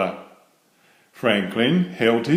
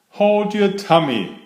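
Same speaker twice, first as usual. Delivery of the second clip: Fox's advice; 8 semitones higher than usual. Acted. Fox voiced by narrator.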